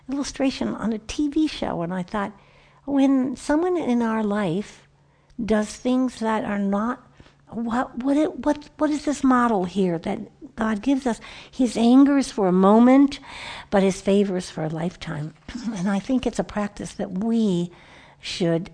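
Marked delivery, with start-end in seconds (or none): none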